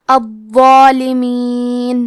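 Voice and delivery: الظالمین very slow, the doubled ظا thick and heavy and the alif long; الظالمین with a heavy, full ظا held for two beats; الظالمین, the ظا made thick and stretched; heavy zha. Shown as a demonstration. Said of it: "دونوں کو بھاری آواز میں ادا کرنا".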